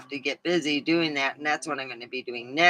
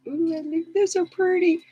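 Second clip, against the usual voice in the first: high, cooing mock delight; girly gush. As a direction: coo over the nails like a girl. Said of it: "I'm like".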